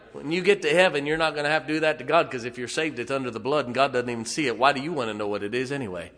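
rising pulpit shout; fiery preaching. Real, not acted. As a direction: preach it hard and loud. Preaching at full force.

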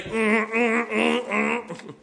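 high, repeated "mm" grunts; whiny little wordless "mm, mm"; imitated kid noises. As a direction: mimic a kid grabbing at candy with little high hums. Kids reaching for candy, imitated.

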